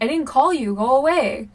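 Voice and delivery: annoyed. Retold or imitated. Sister shooing brother from bathroom door, annoyed. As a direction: snap it to shoo him off. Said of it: "I got really annoyed".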